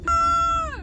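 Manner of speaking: short startled shriek; sudden high scream. Real, not acted. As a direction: yelp in a sudden fright. Sudden scare in car.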